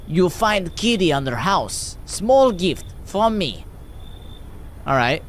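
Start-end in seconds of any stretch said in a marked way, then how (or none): 0.1-3.6 put-on slow, heavy Russian accent; drawn-out hammy Russian accent. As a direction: read it as a deliberate Russian stranger. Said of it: "I'm gonna read this in a Russian accent".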